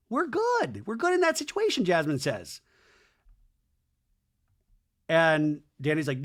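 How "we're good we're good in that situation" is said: put-on very high voice; imitated high insistence. Jasmine reassures Daniel about the situation, high mock insistence. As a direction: pitch it way up, imitating a woman.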